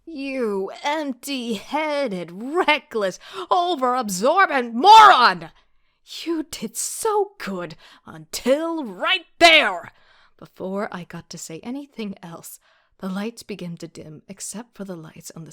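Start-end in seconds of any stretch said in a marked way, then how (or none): 0.1-9.9 high, very loud slow tirade; furious yell. Acted. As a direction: blow up at him. Exploding at Rowan.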